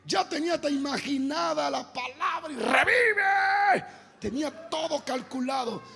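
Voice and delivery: high, loud preacher's lament; worked-up preaching. Real, not acted. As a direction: protest over ruined plans, pitch soaring. Preacher recounts frustrated plans. (predicación exaltada en tono agudo)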